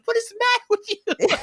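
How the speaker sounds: high, incredulous hammed-up scold; exasperated. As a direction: scold a clueless friend in disbelief. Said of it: "Sean's like".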